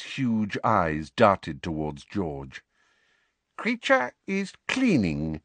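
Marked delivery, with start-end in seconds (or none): 3.6-5.4 slow, high, innocent-sounding elf voice; evasive elf. A narrator's reading. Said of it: "he said evasively".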